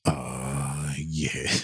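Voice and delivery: low, hushed soul-crooner rumble. Acted. Answering co-host's impersonator remark, deep crooner impression. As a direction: drop into a deep, slow seductive drawl.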